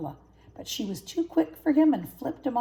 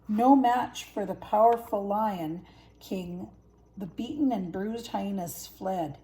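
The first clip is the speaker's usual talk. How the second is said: ordinary delivery, nothing marked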